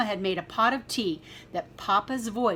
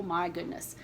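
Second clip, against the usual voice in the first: ordinary delivery, nothing marked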